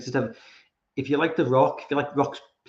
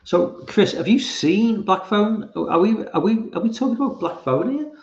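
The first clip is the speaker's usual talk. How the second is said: teasing high voice; 9 semitones higher, 3 dB louder than usual. Real, not acted. Teasing a chatter, high and slow.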